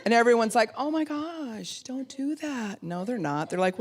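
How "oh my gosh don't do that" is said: mock gasp of concern. Imagined onlookers pleading with the self-harmer, high slow mock alarm. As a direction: play the overdone worried onlooker.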